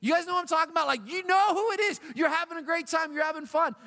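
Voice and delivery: high-pitched, worked-up appeal; excited, insistent storytelling; animated appeal. Real, not acted. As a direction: pitch it high, urging the crowd to agree. Excited appeal to the audience about fun suckers.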